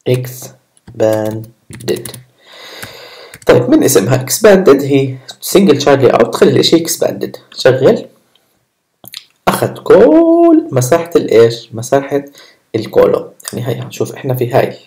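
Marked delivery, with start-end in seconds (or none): 0.1-1.4 drawn out in separate syllables; slow dictation with gaps between syllables. Real, not acted. Spelling out widget name while typing. 9.5-11.1 كل stretched long, high and loud; big drawn-out emphasis on كل; stress on كل. Real, not acted. Stressing that it took all space.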